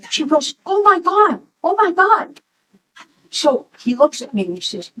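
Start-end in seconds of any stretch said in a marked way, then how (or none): 0.7-2.4 high-pitched mock astonishment; shocked daughter. Daughter learns about the mob boss, shocked. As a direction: exclaim it twice, imitating a stunned daughter.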